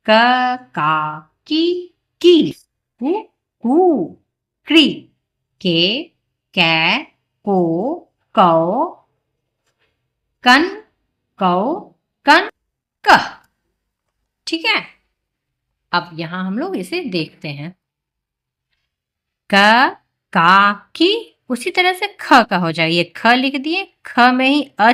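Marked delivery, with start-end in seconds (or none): 0.1-13.2 loud, drawn-out chant of syllables; reciting the barakhadi. Shown as a demonstration. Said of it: "अब इसे पढ़ेंगे कैसे?". 19.5-21.1 loud, slow syllable chant; drawn-out recitation. Shown as a demonstration. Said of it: "आप बोलिए".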